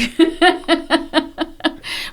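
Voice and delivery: big burst of laughter, pitched high; high amused laugh. Real, not acted. Laughing at own joke about yelling back at a kid, high laugh. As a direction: let a real laugh break out high.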